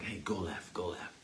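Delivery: soft, low inner voice; a quiet nudge from inside; spirit nudging softly. Pretend. Spirit whispering a warning, soft and low.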